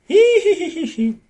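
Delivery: very high, loud, drawn-out neigh; horse whinny. A narrator's reading. Horse chiming into the conversation, high whinny. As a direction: imitate a horse neighing shrilly. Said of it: "agregó el caballo".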